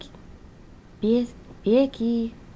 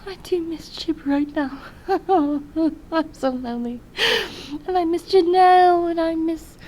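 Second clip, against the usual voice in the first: half-joking sigh of loneliness; mock lonely whine; 8 semitones higher, 7 dB louder than usual. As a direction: play at pining for friends. Jokingly laments missing friends.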